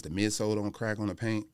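normal talking voice

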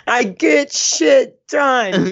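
put-on drunk man's bellow, drawn way out; Hopper. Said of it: "he just chugged another beer".

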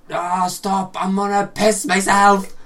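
loud, high strained mock plea, drawn out; mock desperate yell while being tickled; tickled pleading. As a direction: beg the tickler to stop, half-yelling. Acting out being tickled helplessly.

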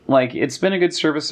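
usual speaking voice

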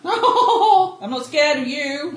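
loud, very slow laughing defiance; false bravado. Acted. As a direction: puff up and taunt it. Character turns brave, laughing.

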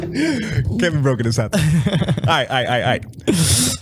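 slow words through laughter; laughing. Real, not acted. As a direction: chuckle while mocking his line. Laughing at friend's line.

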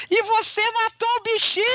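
high mock outraged squeal; imitating Sara. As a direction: squeal it like a scandalised animal lover. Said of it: "E Sara".

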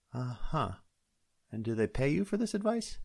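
a sceptical uh-huh, then a pointed question; skeptical deputy. Acted. Deputy doubting the sheriff's hobby, high and sceptical.

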